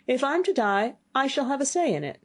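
high, cocky retort; defiant youth. Acted. Youth defies the fiend's threat.

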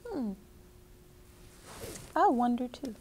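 usual speaking voice

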